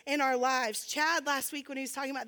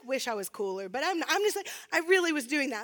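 ordinary talk, nothing marked

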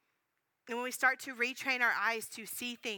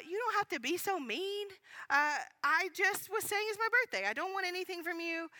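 higher-pitched, wounded defensiveness; hurt protest; 7 semitones higher than usual. Retold or imitated. Woman protests to her mean friend, mildly hurt. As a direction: act out a stung, defensive reply.

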